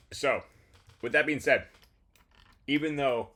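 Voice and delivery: talking through a mouthful of popcorn; chewing while talking. Real, not acted. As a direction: speak around a bite of popcorn. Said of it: "excuse me, I am eating popcorn".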